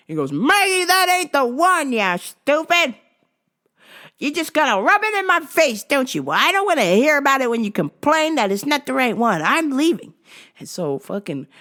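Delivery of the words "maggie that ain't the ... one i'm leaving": put-on high, loud bickering old man; husband voice. Earl scolding Maggie over vitamins, raised bickering. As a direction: scold your wife.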